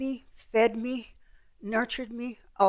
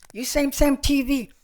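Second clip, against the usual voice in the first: put-on higher-pitched Vietnamese-accented broken English; raised voice, imitated Vietnamese accent; 4 semitones higher, 3 dB louder than usual. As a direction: mimic the Vietnamese waiters insisting. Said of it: "They said".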